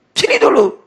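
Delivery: loud, high husband's summons; calling out. Acted. Husband calling wife before prayer.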